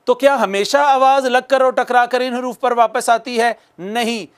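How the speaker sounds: ordinary talk, nothing marked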